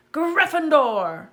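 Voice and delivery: loud, slow, drawn-out strange hat cry; hat shouting. A narrator's reading. Sorting Hat shouting the house to the hall. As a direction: bellow the house name out. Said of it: "shouted the hat".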